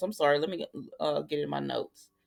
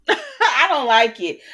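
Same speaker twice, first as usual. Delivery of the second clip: laughed through, louder and slow; laughing; 6 semitones higher, 9 dB louder, slower than usual. Real, not acted. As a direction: laugh at your own change of heart. Laughs at own reversal.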